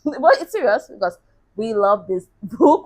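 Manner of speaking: laughing at herself. Real, not acted. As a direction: giggle while insisting it's serious. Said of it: "I'm laughing at myself".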